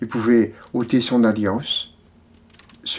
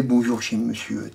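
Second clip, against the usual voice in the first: softer, lowered echo of the greeting; subdued quoted greeting; 3 semitones lower, 6 dB quieter than usual. Acted. Reader echoing a man's habitual hello, subdued. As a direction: repeat the greeting low and soft. Same greeting repeated low and soft.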